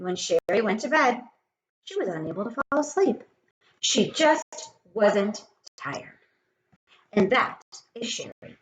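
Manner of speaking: plain reading voice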